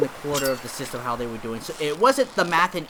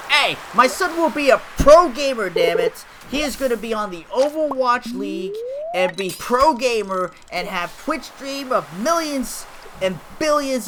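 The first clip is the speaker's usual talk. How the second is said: loud, higher mock proud parent boasting; pushy parent; 5 semitones higher, 4 dB louder than usual. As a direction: play the overbearing dad bragging about his son. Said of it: "put such pressure on my parents".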